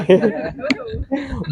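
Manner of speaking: soft laugh, high and falling; burst of laughter. Real, not acted. Teacher hearing the nickname Dodo, laughing softly.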